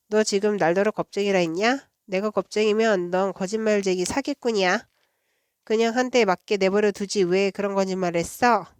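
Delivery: ordinary delivery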